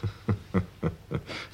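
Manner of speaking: a soft, voiceless, knowing laugh; quiet short laugh. Acted. Cornered suspect laughs quietly when the inspector has it all worked out. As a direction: laugh under the breath, giving up.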